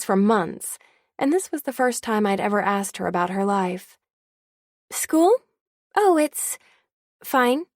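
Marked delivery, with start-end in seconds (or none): 4.9-7.7 flustered brush-off, pitched up; surprised student dodging the question; startled. Acted. Startled Stephanie caught off guard.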